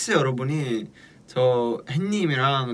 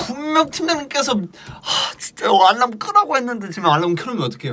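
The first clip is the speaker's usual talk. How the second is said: exasperated complaint; 10 semitones higher, 5 dB louder than usual. Real, not acted. Alarm left on, scolding partner.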